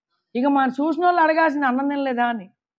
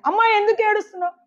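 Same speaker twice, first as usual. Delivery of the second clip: raised-pitch, quick question; preacher voicing a divine question; Lord questioning Mary; 9 semitones higher, faster than usual. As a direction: ask the weeping woman with concern.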